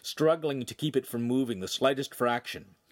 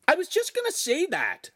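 high, loud indignant snap; quick, stung comeback; a boy snapping back, a bit louder; flashing retort; 5 dB louder than usual. Acted. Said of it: "Lincoln flashed".

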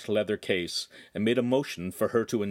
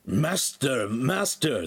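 raspy servant's cry, raised pitch; harsh, raspy call; 5 semitones higher, much slower than usual. A narrator's reading. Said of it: "called in a harsh, raspy voice".

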